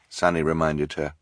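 ordinary delivery

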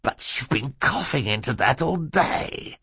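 villain squirming out of it; whining villain. A narrator's reading. Villain objects to the handkerchief.

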